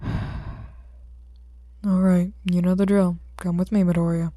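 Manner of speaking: you know the drill, sigh and order; weary cop ordering the boy along; gruff detective. Acted. Detective resigned to routine.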